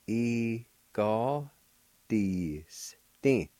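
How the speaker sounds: e ga di stin spoken in separate syllables. Shown as a demonstration. Teacher showing learners the second part, sounded out. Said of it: "Second part".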